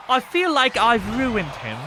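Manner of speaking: cute British tang, falling at the end; British accent. Acted. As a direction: say it slowly in a put-on British accent. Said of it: "Every time I do a British accent".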